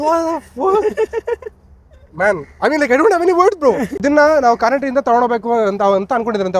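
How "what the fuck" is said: slow, drawn-out disbelief; stunned disbelief. Real, not acted. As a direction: stretch it out stunned. Disbelief at Turbo S.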